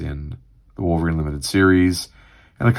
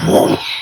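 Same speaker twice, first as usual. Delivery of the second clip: loud breathy whoosh; rushing fire noise; fire sound effect; 5 dB louder than usual. Shown as a demonstration. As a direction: make the whoosh of a burst of flame. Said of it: "fire sound effect of".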